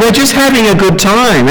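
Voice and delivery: breezy mock partygoer; voicing non-Christians. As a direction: play the easygoing pleasure-seeker. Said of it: "That's all they're thinking".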